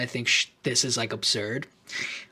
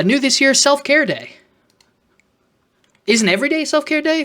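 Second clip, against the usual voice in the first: sarcastic brochure read; 10 semitones higher, 4 dB louder than usual. Real, not acted.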